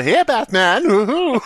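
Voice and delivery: high silly sidekick squeal; lightly voiced cartoon sidekick; goofy Robin voice.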